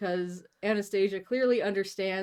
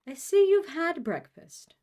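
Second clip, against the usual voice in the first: high, louder theatrical mother; mother's society voice; 10 semitones higher, 6 dB louder than usual. Acted. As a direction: observe it as a high-voiced grand lady. Mother's put-on voice in story.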